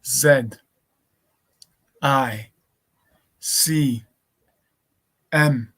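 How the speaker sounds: slow, letter by letter with long gaps; dictating each letter slowly. Shown as a demonstration. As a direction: spell it out slowly for someone to copy down. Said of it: "I'll slow it down".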